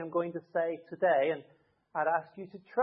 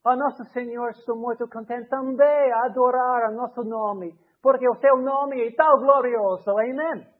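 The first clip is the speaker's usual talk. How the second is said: fervent public prayer in Portuguese, rising in volume; praying aloud in Portuguese; 3 semitones higher, 5 dB louder than usual. Shown as a demonstration. Preacher imagines praying aloud in Portuguese at the prayer meeting. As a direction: lift a glorious prayer in Portuguese. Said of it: "I stand up and I say".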